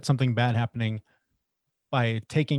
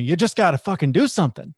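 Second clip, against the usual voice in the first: imitated accented yell, higher and louder; [name]'s mock yell; 5 semitones higher, 5 dB louder than usual. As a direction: mock-scream it like a pushy coach with an accent. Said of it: "with his accent screaming at me".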